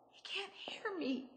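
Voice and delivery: hushed, to herself; whisper. Acted. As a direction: whisper it in despair. Trapped, speaking to herself.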